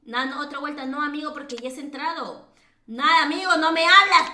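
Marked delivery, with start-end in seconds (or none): 2.9-4.3 loud, frustrated outburst; exasperated scolding. Real, not acted. Host to a player who won't speak, exasperated scolding. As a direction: scold them at raised volume.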